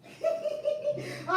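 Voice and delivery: high giggling burst after a joke; high burst of laughter. Real, not acted. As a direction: crack up at your own bit. Laughs after her ASMR bit.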